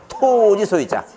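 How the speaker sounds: 토 in 토지 소유자 drawn out high; memorable emphatic reading. Shown as a demonstration. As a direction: chant a long, high 토, then drop through 지 소유자. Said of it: "뭐라 읽자고?".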